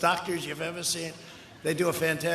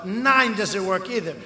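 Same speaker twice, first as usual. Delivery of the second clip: normal talking voice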